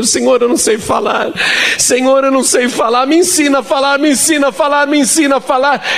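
high-pitched, repeated begging, building in urgency; desperate frightened pleading. Retold or imitated. Preacher re-enacting his fearful prayer to learn to speak, desperate. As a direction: re-enact a desperate cry to God, pitch soaring. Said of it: "E eu tinha tanto medo".

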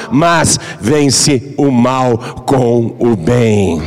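solemn, slow Bible reading; scripture reading. Acted. The preacher reads the Bible verse to the congregation, solemn and slow. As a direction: read the verses with solemn weight.

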